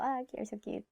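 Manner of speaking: soft cooing over something small; a hint of soft cooing. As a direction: coo it gently like to a little kid. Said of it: "we say this, like, for small people".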